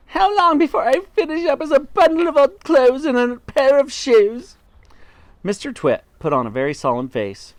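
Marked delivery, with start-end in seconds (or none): 0.1-4.6 sustained high, loud wail; wife crying out. A narrator's reading. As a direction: wail the whole question in panic. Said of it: "cried Missus Twit".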